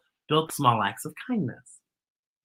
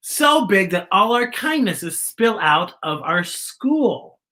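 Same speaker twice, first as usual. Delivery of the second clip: put-on reading to children; 5 semitones higher than usual. Teacher reading a picture book to her class, building wonder. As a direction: paint the kindness growing bigger and bigger for the kids.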